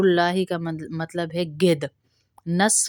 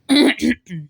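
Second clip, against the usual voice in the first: throat clearing; 6 semitones higher, 7 dB louder than usual. Real, not acted. Teacher pauses recitation.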